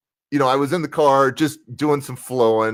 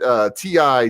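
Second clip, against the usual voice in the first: ordinary talk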